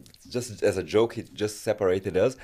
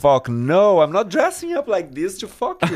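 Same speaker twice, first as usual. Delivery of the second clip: high, laughing mock refusal; 11 semitones higher, 8 dB louder than usual. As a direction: laugh it off while retelling the no. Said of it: "I was like".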